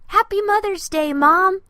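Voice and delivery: high, loud, happy shout; a boy cheering for his mom; loud joyful child's cry; joyful exclamation. A narrator's reading. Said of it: "Andy exclaimed".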